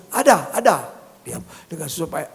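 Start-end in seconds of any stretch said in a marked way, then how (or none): 0.1-0.7 high, loud interjection; blurted shout. Retold or imitated. Son contradicting his mother from the bed, shouted. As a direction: burst out, cutting in.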